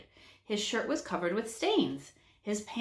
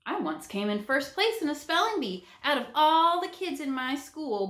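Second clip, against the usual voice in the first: a touch of pride, slightly raised; proudly; 7 semitones higher than usual. A narrator's reading. Hobo telling the class his triumph, mild pride. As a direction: lightly boast about the win. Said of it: "Bob said proudly".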